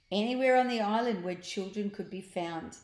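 ordinary delivery, nothing marked

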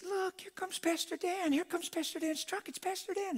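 put-on very high, squeaky child voice; excited kids imitation. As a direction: mimic children squealing at a sighting.

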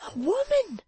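high-voiced, aghast realisation; startled high woman's voice; shocked recoil. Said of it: "she said, recoiling".

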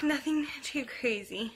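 ordinary talk

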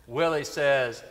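usual speaking voice

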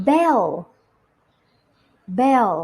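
"bell" as a careful, separate word; slow, clear model. Shown as a demonstration. Teacher models "bell" for children to repeat, slow and clear. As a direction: pronounce "bell" distinctly with a falling tune. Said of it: "อ่านตามพี่ช้าๆช้าๆชัดๆ".

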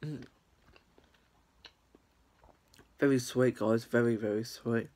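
muffled words between bites; chewing while talking. Real, not acted. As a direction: mouth partly full of cake. Seen 0: Bitten cake in hand, closed working mouth, then talks with food.

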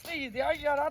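usual speaking voice

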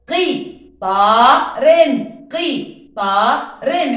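قي طارن split, loud and slow; syllable segmentation. Shown as a demonstration. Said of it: "نقطع صوتي". (تقطيع قي طارن)

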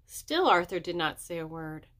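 plain reading voice

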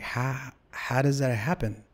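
stunned slow question; slow disbelief. Retold or imitated. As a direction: drag it out in disbelief. Incredulous at lost tape.

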